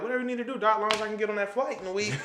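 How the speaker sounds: high-pitched, carefree retold reply; acting out a casual whatever-you-say; breezy agreement. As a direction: toss it off high and easy. Retells his breezy answer to doctor.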